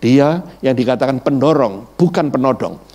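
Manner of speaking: ordinary talk, nothing marked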